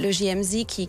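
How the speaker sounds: ordinary talk, nothing marked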